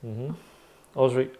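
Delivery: normal talking voice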